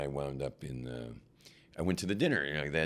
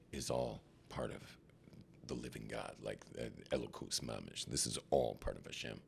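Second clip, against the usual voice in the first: awed revelation; 6 semitones lower, 9 dB quieter than usual. Retold or imitated. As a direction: hush it with wonder. Moment of spiritual revelation.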